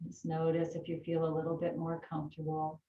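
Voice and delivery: calm yoga teacher voice; unhurried breathing instructions; guided breathing. Acted. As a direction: talk them gently through the breath.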